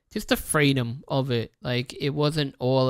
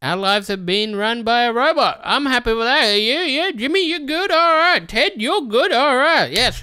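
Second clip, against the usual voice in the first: high, loud, cheery corporate voice; high-pitched parody of a YouTube boss; mocking YouTube exec; 9 semitones higher, 8 dB louder than usual. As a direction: play a smug exec waving everyone through, high and loud. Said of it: "YouTube fucking headquarters or whatever are like".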